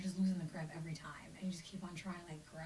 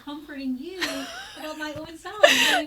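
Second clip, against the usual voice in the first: voice breaking; 9 semitones higher, 13 dB louder than usual. Real, not acted. Grieving, voice breaks.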